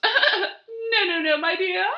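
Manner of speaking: high, clucking condescension; clucking hen. Acted. Dot scolding Zinnia about eggs, high clucking. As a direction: cluck it down at your rival. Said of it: "she clucked".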